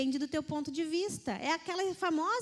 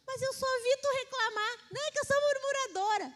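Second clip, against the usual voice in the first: much higher, quicker silly self-excusing voice; high mock complainer; 10 semitones higher, faster than usual. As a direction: squeak out the excuse in fun. Retold back-and-forth with sister.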